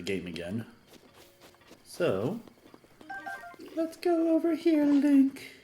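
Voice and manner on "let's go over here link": high, sweet princess voice; lilting fairy tale princess; Princess Zelda voice. Pretend. Reading game dialogue in character.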